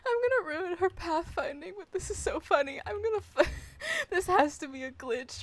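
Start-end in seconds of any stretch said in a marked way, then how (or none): 0.0-2.8 words breaking up with laughter; giggling through the line; laughing. Real, not acted. Streamer cracking up at glitch.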